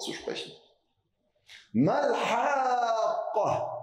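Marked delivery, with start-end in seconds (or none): 1.7-3.8 Haqqa held very long, high and loud, six beats on the vowel; long drawn recitation. Shown as a demonstration. Teacher demonstrating Tajwid, stretched long and loud. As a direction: chant Haqqa slowly with a heavy drawn-out vowel. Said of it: "sehr lang gezogen wird".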